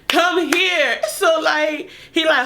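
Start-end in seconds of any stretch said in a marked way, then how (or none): 0.1-1.0 laughing. Retold or imitated. Partner's voice, laughing.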